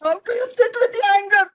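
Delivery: high, loud, exasperated whine; acted tearful indignation; angry child. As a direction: throw the advice back in a tantrum. Boy mocks grandpa's advice angrily.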